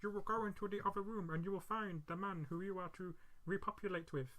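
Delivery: soft, lightly voiced alien pronouncement; a hint of solemn alien messenger; alien recording. Aliens briefing the chosen girl, lightly voiced solemn announcement. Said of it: "the aliens are like".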